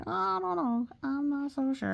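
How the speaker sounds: lightly voiced worried cat; unsure cat voice. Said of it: "this one's like".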